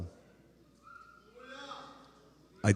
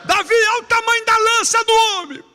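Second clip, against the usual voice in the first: high, much louder jeering shout; louder shouted taunt; 13 dB louder than usual. Retold or imitated. Soldiers jeering at Davi, even louder shout. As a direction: belt it out harder, mocking.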